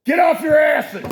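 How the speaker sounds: high, loud barked order; shouted command. Real, not acted. Host shouts at listeners to act, loud angry command. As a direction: yell it at the lazy listeners.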